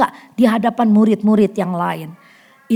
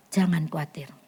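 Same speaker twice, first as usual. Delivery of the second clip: whispered comfort; 7 semitones lower, 11 dB quieter than usual. Real, not acted. Pastor comforts hurt listeners.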